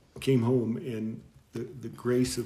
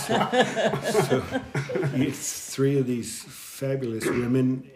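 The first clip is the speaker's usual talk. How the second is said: laughing; 2 semitones higher, 4 dB louder than usual. Real, not acted. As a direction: laugh while you tell it. Laughing about sisters praying.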